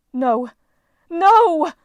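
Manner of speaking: rising, very loud desperate cry; trapped goddess shrieking; frantic no. A narrator's reading. Goddess thrashing in net.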